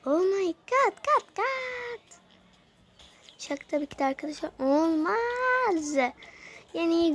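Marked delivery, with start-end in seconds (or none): 0.1-2.2 oh my god rising to a high squeak; hammed-up gasp of disbelief; mock shock. Kid reacting to a costume with mock shock.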